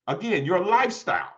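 ordinary delivery

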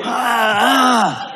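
high mock wail from onlookers; high-pitched "ah" cries; startled cries. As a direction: imitate passers-by crying out, pitched high. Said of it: "See, they go".

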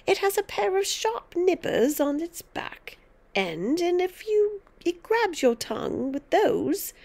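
put-on high, emphatic old woman; gleeful, menacing instruction; relishing grandmother, warning. A narrator's reading. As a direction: warn the child with ghoulish relish.

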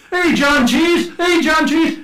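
high, loud, slow taunting holler; mock shouting. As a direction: throw the nickname back at them loudly. Dutch shouting nickname at the English.

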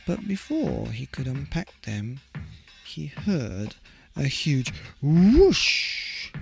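usual speaking voice

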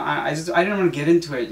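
usual speaking voice

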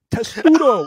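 hammed-up British accent. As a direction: say "Testudo" like a posh British Roman. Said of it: "wonderful British accents".

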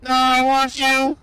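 hammed-up space invader hunting a fleeing human; mock alien yelling come back here; alien chaser. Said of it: "sound like we're from another planet".

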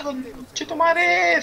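long drawn-out high yell; power up scream. Real, not acted. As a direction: stretch it into a huge rising scream. Big play erupts mid-game.

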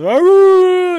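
long, high, loud wail; hammed-up madman's howl; crazed mock scream. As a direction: let out a wild, sustained yell as if running amok. Imitates Trump running around in underwear.

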